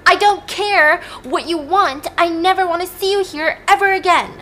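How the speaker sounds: high, loud, emphatic refusal; angry rejection. Acted. Kaya sending Tate away, acted anger. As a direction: cut him off in anger, high and forceful.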